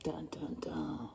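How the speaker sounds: soft whispered dun dun dun; hushed mock suspense chant.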